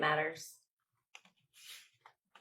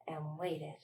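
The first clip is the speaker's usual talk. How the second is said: whispered, trailing off; 4 semitones lower, 13 dB quieter than usual. Acted. Librarian reading to children, whispering the last wait. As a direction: breathe it out with no voice. Storytime building suspense.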